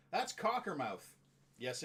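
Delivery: ordinary talk, nothing marked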